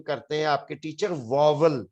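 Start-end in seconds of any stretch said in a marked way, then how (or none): 1.1-1.9 واول said as VOV-ul, with a second v; common mispronunciation. Shown as a demonstration. Teacher quoting other teachers to students, mispronounced as VOV-ul. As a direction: say واول as two syllables, "vo-vul". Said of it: "واول اس کا pronunciation نہیں ہے".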